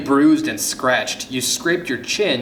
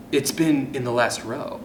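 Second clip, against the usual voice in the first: ordinary delivery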